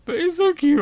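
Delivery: high, loud gushing squeal; whiny plea for a cute pet; cute-struck whine. Pretend. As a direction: squeal with wanting, starting very high. Cooing over a cute animal.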